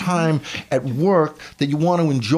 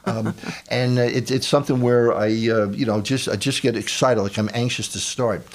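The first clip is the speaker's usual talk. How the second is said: ordinary talk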